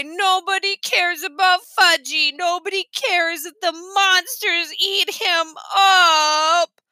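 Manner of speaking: slow, high crying whine; crying child. A narrator's reading. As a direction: sob it out like a hurt little boy. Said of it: "Fudge started to cry".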